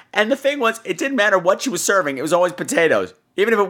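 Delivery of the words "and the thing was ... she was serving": ordinary delivery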